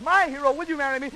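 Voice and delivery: ordinary delivery, nothing marked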